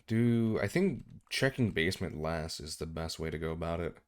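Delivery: usual speaking voice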